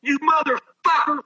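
high slow retold scream; screaming at umpire. Hungover batter screaming at the fat umpire, high mock yell. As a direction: throw the curse right in his face, high and drawn out. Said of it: "I start screaming".

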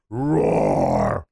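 long, low, drawn-out roar; lion roar. Shown as a demonstration. Coach demonstrating confident words, low lion roar. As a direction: roar slowly like a big lion.

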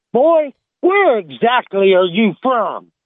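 the fed-up warden finally loses patience; mad warden. Acted. A fed-up warden demands to know where the hillbilly is from, mildly angry. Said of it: "he's mad then".

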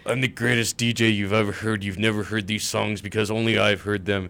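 loud mock radio boasting; boastful former DJ. Co-host imitating a short-lived former DJ, boastful. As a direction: brag it loudly, mocking a self-important DJ. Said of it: "We were talking about a K U, uh, former D J".

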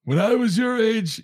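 put-on high, slow grumpy old-timer; mock the old guy telling the kids. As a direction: when I was your age, gramps style. Mocking old-timer cliché.

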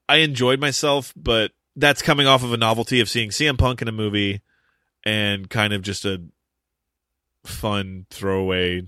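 ordinary delivery

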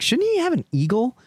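high-pitched imitation of clueless fans; mock naive questioner. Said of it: "they're like".

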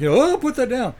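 high, loud mock startle; hammed-up yelp at having a rifle aimed at you; mock alarm. Man facing the hunter's rifle, mock alarmed.

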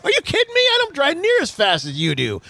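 imitating wife's high-pitched protest; acted indignant retort. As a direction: voice shoots up, mock-offended comeback.

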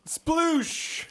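loud high splash effect; splashing sound. Retold or imitated. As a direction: shout a big splash. Car crashes into a pond.

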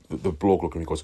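normal talking voice

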